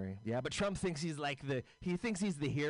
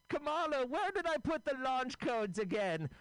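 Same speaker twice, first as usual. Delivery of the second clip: put-on much higher, doddering old man voice; a befuddled president, pitched way up; confused old Joe; 10 semitones higher than usual. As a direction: play him lost and calling for help. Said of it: "So here's my impression of".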